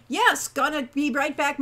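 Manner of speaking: ordinary delivery, nothing marked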